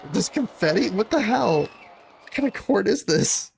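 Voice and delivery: high, disbelieving cries of confusion; baffled outburst. Acted. Defendant reacting to confetti falling in court, high and baffled. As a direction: sound utterly thrown by the confetti.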